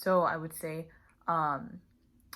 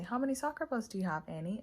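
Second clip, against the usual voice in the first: much higher and quicker, rising on the name; quick, bright, coaxing question; cheerful teacher question; 5 semitones higher, faster than usual. Shown as a demonstration. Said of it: "you say".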